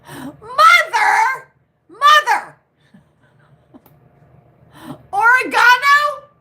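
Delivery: high, loud cry of laughing disbelief; laughing at the word in disbelief; laughing exclaim. Real, not acted. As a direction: shriek it through the laughter.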